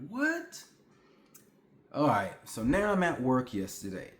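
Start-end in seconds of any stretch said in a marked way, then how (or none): 0.1-0.6 baffled what. Retold or imitated. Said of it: "And I'm just like".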